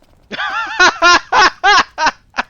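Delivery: big loud high cackle of laughter; loud shrieking laugh. Real, not acted. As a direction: burst out laughing loudly. Laughing at friend's mistake.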